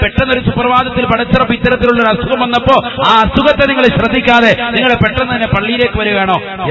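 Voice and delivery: loud, high-pitched pleading protest; urgent remonstrating. Acted. Voicing neighbours' urgent protest to man. (ഉച്ചത്തിൽ, ഉയർന്ന സ്വരത്തിൽ എതിർത്തു ചോദിക്കുന്നു)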